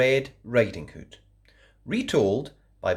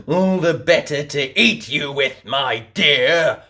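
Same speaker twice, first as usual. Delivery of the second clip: louder snarl, disguise dropped; snarling wolf; 2 semitones higher, 6 dB louder than usual. A narrator's reading. Wolf dropping the disguise before pouncing, snarled. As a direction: snarl it as the wolf shows teeth. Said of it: "snarled the wolf".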